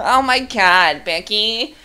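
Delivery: high, slow, drawn-out girly put-on voice; hammed-up Becky voice, stretching the words; mock Becky type. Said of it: "I hate Becky too".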